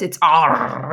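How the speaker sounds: drawn-out pirate "R" like Arrr. Shown as a demonstration. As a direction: say "R" like a growling pirate. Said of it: "or with my best pirate voice".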